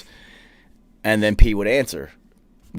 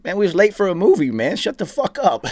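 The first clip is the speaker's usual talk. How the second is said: mock yell; 10 semitones higher, 4 dB louder than usual. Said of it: "you waited until we were already past you to yell".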